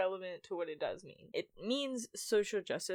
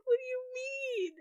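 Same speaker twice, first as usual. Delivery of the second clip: put-on much higher, bemused little boy; imitating a giggly boy. Playground boy laughing at her, high bemused voice. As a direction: squeak it out, amused and puzzled. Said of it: "He's like".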